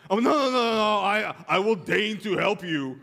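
hammed-up reluctant husband; sarcastic protest. Husband mocks himself in quarrel.

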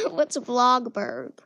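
laughing. Real, not acted. Teasing herself about mispronouncing.

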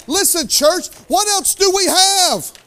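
high, slow, urgent call out; preaching at full force; fervent preaching. Real, not acted. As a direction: press the church for an answer. Preacher calling out to congregation.

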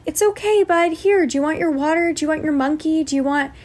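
put-on cheering up a child. Old me distracting an upset boy, high upbeat soothing. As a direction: offer comforts brightly to distract him. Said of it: "I could hear my initial instinct, old me".

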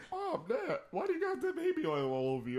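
high-pitched retold child's question; lightly hammed-up kid voice; innocent kid. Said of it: "I'm just like".

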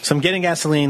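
ordinary talk, nothing marked

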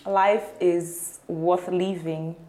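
very slow, measured delivery; boring answer. Real, not acted. Guest answering the host's "How's life?", slow and boring. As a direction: draw it out slowly, without energy. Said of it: "What kind of boring answer is that".

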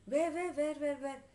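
high, loud rapid-fire repeats; frantic questioning. A narrator's reading. Mother looks around everywhere, frantic questioning.

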